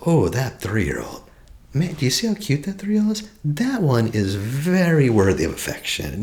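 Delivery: high, gushing mock admirer; doting adult, mocking. Coach mocks an adult praising a cute child, gushing high. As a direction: gush over the cute toddler, tongue in cheek. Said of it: "Who looks at three-year-old and says".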